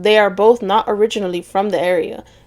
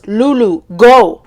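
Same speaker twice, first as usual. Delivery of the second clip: raised, urgent call, building to GO; retold yell; 7 dB louder, slower than usual. A narrator's reading. Man yelling at his date to run, retold yell. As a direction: call out a warning, loudest on the last word. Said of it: "and he yells".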